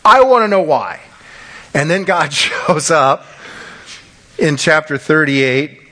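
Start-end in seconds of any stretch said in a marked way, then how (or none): 0.0-1.0 Job demanding answers. Retold or imitated. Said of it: "And he's like".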